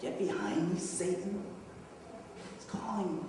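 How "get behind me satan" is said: softer, questioning echo; imagined rebuke. Retold or imitated. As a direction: repeat the rebuke quietly with a rising end. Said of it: "imagine Jesus saying to you".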